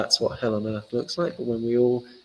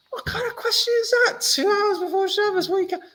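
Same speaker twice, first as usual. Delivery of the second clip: much higher, squealing mock fury; hammed-up outrage; 3 dB louder than usual. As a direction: overplay the indignant sage losing it. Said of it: "That's not how he responded".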